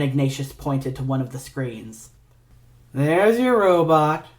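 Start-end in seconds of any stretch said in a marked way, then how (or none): 2.9-4.3 slow, higher lazy drawl. Acted. Ignatius showing Three the captive robot, lazy slow drawl. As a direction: stretch it out lazily. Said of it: "he drawled".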